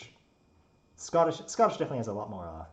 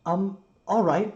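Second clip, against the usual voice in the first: high, slow, bewildered assent; loud but uncertain, rising at the end; hesitant builder; 9 semitones higher, 11 dB louder, slower than usual. Acted. Builder responds hesitantly to scolding.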